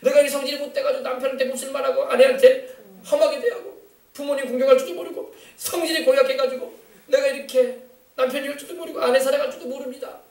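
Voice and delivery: high-pitched confession, piling up faults; demonstrated repentant prayer. Shown as a demonstration. Preacher showing spouses how to confess bad temper, pleading mock prayer. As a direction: model a contrite plea to God. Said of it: "막 회개해야 돼요".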